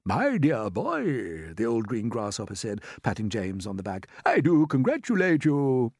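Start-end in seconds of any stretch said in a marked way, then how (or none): 0.1-1.5 put-on slow, drawn-out elderly gentleman; stately old fellow, deeply moved; old grasshopper. A narrator's reading. Grasshopper congratulates James. 4.2-5.9 put-on higher, loud elderly congratulations; old gentleman, heartily impressed; old grasshopper. A narrator's reading.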